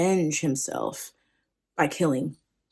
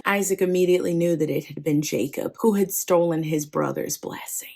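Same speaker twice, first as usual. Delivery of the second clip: plain reading voice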